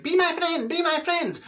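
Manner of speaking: high wheedling be my friend; pleading friend request. Retold or imitated. Old schoolmates begging on Facebook, in a high wheedling voice. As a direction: beg in a high sing-song. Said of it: "it's like".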